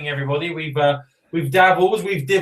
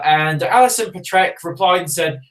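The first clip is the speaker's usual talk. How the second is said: ordinary talk, nothing marked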